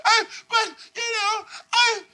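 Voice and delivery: high, halting sobby protest; hammed-up weepy wail; mock crying. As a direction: play the wronged victim bursting into tears. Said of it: "They'll hit you with some crying".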